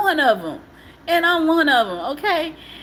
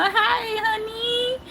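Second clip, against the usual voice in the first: sweet greeting; 9 semitones higher, slower than usual. Retold or imitated. Imagined happy phone greeting.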